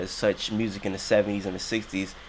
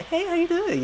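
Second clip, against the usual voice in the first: high, breathy flirting put-on; sexy voice. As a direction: flirt with someone cute. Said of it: "you might put on a sexy voice".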